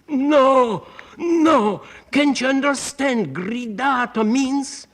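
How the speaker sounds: high, loud outburst of exasperation; imitated maestro scream. Conductor halting a singer mid-rehearsal, imitated scream. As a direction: cry out like a maestro at the top of his voice. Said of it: "Maestro screamed at the top of his voice".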